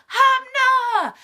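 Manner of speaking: high, loud call across a dark room; calling Hamna across the crowded theater. Retold or imitated. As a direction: shout her friend's name to get her attention.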